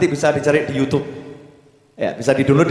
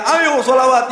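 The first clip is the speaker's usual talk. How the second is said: high, loud call to chant; rally the crowd with a loud cry; shouting; 9 semitones higher, 4 dB louder than usual. Retold or imitated. Preacher imitating [name]'s loud call to the congregation. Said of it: "Habib Syekhnya teriak-teriak".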